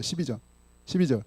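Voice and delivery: ordinary delivery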